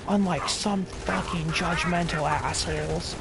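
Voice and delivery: man glaring at the tattler, lightly mocked sneer; snide junkie. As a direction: lightly voice a sarcastic druggie taking a dig. Mocking the snide junkie husband.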